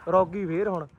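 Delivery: normal talking voice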